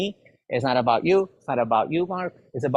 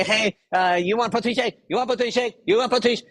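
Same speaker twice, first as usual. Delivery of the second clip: pushy salesperson; 7 semitones higher than usual. Retold or imitated.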